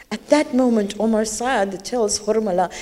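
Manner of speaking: ordinary delivery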